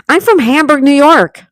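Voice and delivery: high, loud excited announcement; real excitement. Real, not acted. Streamer telling Ann they share a Hamburg, excited. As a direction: blurt it out, thrilled at the coincidence.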